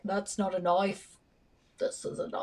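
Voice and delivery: softly imitated Australian movie line; mock Australian accent. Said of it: "You were like".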